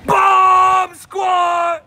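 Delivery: high, full-volume battle cry; huge drawn-out holler; screaming. Acted. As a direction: scream it like a boxer's catchphrase. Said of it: "y'all got me screaming".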